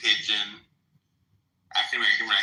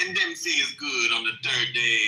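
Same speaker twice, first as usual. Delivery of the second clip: light Jamaican lilt on a scripture line; imitating Jamaican patois. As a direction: echo the Jamaican recording. Mimics Jamaican Bible recording.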